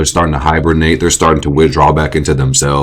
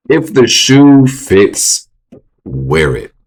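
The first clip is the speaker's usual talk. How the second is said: slow drawn-out; 6 semitones higher, much slower than usual. Acted. Dramatic slow punchline.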